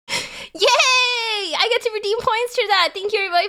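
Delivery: high, squealed cheer; cutesy celebration. Pretend. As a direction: cheer like a delighted catgirl. Celebrating sub goal in cute voice.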